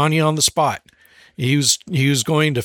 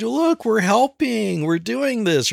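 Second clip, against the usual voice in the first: put-on high, slow sing-song to a toddler; acted coaxing, as if guiding little hands; drawn-out chirpy dad voice; encouraging parent; 7 semitones higher, slower than usual.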